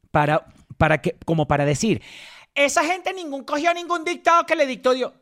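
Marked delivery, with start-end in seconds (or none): 2.6-5.1 high, held-up protest; high insistence. Real, not acted. Insisting nobody took dictation from God, high and emphatic. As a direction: press the point in a raised pitch.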